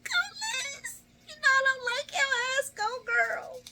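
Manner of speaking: very high, loud squealed cheer. Retold or imitated. Viewer who dislikes Liz rooting for her anyway, cheering on. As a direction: squeal like a hyped fan, voice sky-high.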